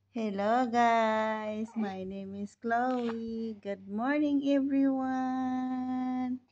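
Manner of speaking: put-on loud, very drawn-out vlog greeting for a baby; Chloe. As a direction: stretch every word, loud and singsong. Speaks as baby Chloe to camera.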